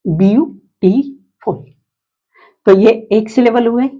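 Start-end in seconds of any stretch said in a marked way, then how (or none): 0.0-1.6 beautiful drawn out slowly as beau-ti-ful; teacher stretches beautiful into three slow beats; slow syllable split. Shown as a demonstration. Teacher to class, splitting beautiful slowly. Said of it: "पहले इसको देख लेते हैं".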